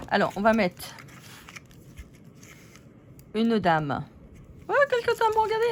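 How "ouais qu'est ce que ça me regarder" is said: tiny doll fed up with her neighbour; squeaky puppet quarrel. Acted. Paper figures start bickering again.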